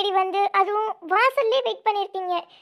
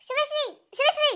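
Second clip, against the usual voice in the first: much higher, stunned repetition; startled gasp; 9 semitones higher than usual. Acted. Sister startled by the man at the door, stunned. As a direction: cry out the name in shock. Shock at sudden arrival.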